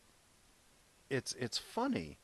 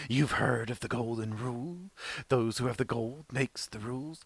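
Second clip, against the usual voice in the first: put-on crafty crippled old man, pitched up and louder; Jafar as old man; 4 semitones higher, 5 dB louder than usual. Disguised villain luring Aladdin in the dungeon, hammed-up old man voice. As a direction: play a disguised old schemer delivering his riddle. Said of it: "this crippled old man comes towards him".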